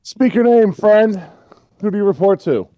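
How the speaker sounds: high, loud demand called down; shouted challenge. Acted. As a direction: shout it like a sentry challenging a stranger. Calls back down to the stranger.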